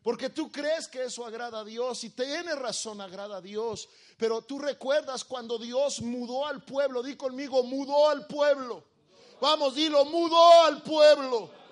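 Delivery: high, loud, full-force preaching; rousing pulpit call that builds to a shout. Real, not acted.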